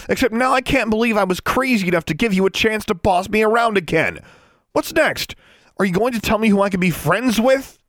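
high, loud furious tirade; outraged rant, pitched very high; fierce yelling. A narrator's reading. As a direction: rail at a bossy brother. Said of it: "she yelled fiercely".

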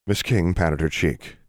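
ordinary delivery

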